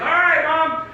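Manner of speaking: high, loud reply to Mom; calling out. Retold or imitated. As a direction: holler an acknowledgement across the house. Calls back down to mom.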